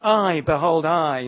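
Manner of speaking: both I's loud and weighty; stress on I. Shown as a demonstration. Preacher quoting God to show the emphasis, stress on I. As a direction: punch "I" each time. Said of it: "the emphasis is more on God".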